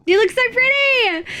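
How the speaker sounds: high-pitched adoring squeal; gushing. Real, not acted. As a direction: squeal the compliment, overcome with delight. Gushing over friends' photos.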